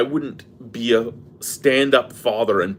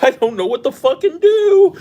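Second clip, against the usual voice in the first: much higher and louder, at the end of his rope; exasperated outburst; 14 semitones higher, 7 dB louder than usual. Retold or imitated. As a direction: throw the line up in frustration. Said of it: "And I'm like".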